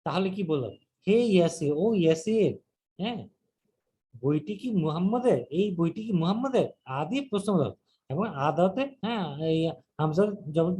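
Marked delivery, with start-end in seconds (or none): none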